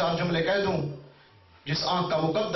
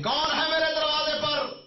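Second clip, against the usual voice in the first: higher call toward the door; 10 semitones higher than usual. A narrator's reading. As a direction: call out again to someone unseen. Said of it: "फिर बीवी ने आवाज दी".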